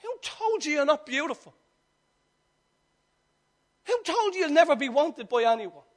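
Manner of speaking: ordinary talk, nothing marked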